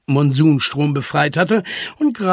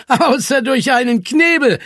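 amused, higher-pitched jab; teasing call; 10 semitones higher, 5 dB louder than usual. A narrator's reading. Zwolle teases the unstoppable voice, calling out amused. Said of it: "rief Zwolle und lachte".

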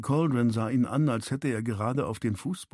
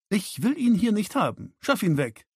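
hostile order; 10 semitones higher than usual. A narrator's reading. Daughter orders the stranger removed.

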